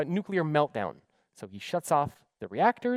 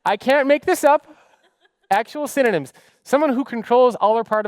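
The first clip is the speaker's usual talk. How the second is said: loud, high laughing disbelief; 7 semitones higher, 9 dB louder than usual. Real, not acted. Speaker laughing at dictionary synonyms for manager.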